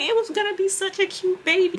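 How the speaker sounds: high falsetto coo; mock gushing. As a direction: imitate coworkers mourning the fake baby. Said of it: "they were like".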